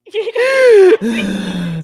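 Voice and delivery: high, loud burst of laughter; helpless shrieking laughter. Real, not acted.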